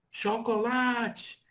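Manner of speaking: "chocolate" called out high and slow, drawn out; calling up a hill. Shown as a demonstration. Teacher calling to someone at the bottom of a hill. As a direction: call "chocolate" up the slope slowly. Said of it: "imagine você falando com a pessoa debaixo de uma ladeira".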